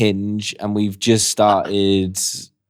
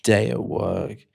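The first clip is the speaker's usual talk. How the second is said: sexy voice note; 5 dB quieter than usual. Pretend. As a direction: play a tired smooth charmer. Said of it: "Can I do mine?".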